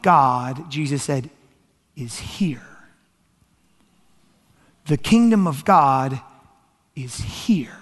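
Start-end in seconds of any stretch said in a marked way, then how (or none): 2.0-2.7 hushed, lower and weighty; hushed emphasis. Real, not acted. Preacher hushes punchline for effect. 7.0-7.8 hushed, lower and weighty; hushed emphasis. Real, not acted. Repeats hushed punchline for effect.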